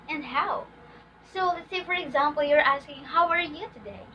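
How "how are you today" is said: "today" dropping in pitch; wh-question tune, falling at "today"; falling intonation. Shown as a demonstration. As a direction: ask it with the voice going down on "today". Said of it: "we are using a falling intonation".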